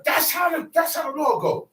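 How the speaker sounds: high, shouted that's how the law go; preaching shout. Real, not acted. Emphatic preaching climax.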